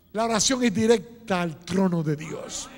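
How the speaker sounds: ordinary talk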